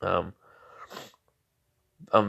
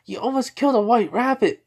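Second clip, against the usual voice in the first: high indignant Sakura; 13 semitones higher than usual. Pretend. Sakura scolds [name] for throwing the kunai, high indignant voice. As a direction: snap at him like an annoyed girl.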